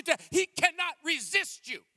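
high, loud preacher build; fiery preaching. Acted. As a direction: drive the sermon upward. Preaching at full force.

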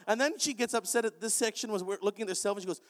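ordinary talk

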